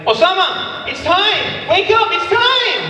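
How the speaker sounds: high, loud urgent call, slowing; waking friend. Retold or imitated. Waking sleeping friend Osama for prayer, high loud shout. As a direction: shout to wake him for prayer. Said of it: "And I'm like".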